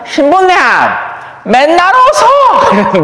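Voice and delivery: mock old lady beckoning the priest, high and slow; elderly parishioner calling. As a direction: call out fondly, stretching the words.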